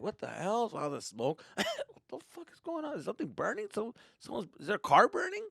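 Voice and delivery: high confused voices; high bewildered strangers; puzzled beachgoers. Retold or imitated. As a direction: wonder where the smoke comes from.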